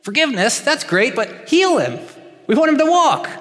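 high, pleading protest, leaning on heal; mock-frustrated friends begging for healing; exasperated friends. Friends on the roof protest to Jesus, high exasperated pleading. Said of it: "I just imagine the four on top of the roof saying".

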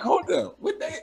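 high confused what day; a sudden baffled pitch jump; surprised. Retold or imitated.

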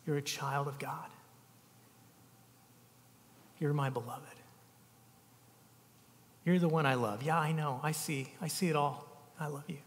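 tender, quiet. Acted. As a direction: speak it like a gentle whisper of love. Jesus voiced naming beloved ones.

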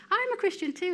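high, bright mock delight; a lightly imitated colleague, pleased. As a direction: play her happy surprise, pitched well up.

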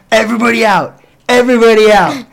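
high, loud shout that eases off; retold yelling. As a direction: bellow like clearing everyone out, then fade. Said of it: "this guy yelling at them".

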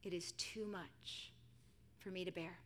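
subdued cry to God; soft, slowing plea under a heavy burden; weary plea. Acted. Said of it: "Paul cried out to God".

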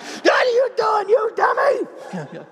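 very high, hammed-up yell; acted angry shout. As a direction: scream it mid-beating, drawing out the end. Yelling while beating him, acted.

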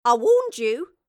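loud exasperated snap; scolding shout. A narrator's reading. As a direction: told you so, with a swat. Mary swats the boy.

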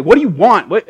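loud, high grumpy outburst at being woken. Retold or imitated. Elijah woken by the angel, grumpy. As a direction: snap like someone woken from a nap. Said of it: "he was kinda grumpy".

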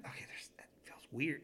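hushed, uneasy whisper; nervous whisper. Retold or imitated. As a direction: whisper it as if something is in the hallway. Uneasy feeling in dark hallway.

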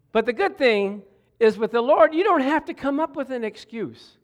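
fervent Sunday preaching. Real, not acted. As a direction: lift the room with good news. Preaching full force.

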